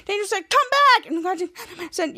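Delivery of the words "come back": shouting. Acted. Character yells come back.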